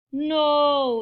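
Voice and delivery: loud drawn-out no; yelled. A narrator's reading. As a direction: answer as a whole crowd. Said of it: "the crowd yelled".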